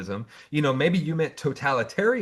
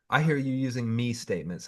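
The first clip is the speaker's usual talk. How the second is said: ordinary talk, nothing marked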